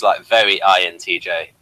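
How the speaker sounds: ordinary talk, nothing marked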